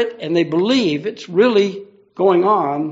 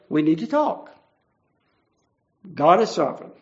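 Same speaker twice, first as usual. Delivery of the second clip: normal talking voice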